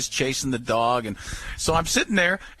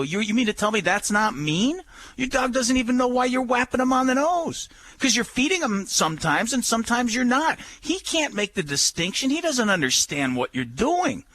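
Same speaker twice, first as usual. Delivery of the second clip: retold lecture; 8 semitones higher than usual. Host retells lecturing the owner.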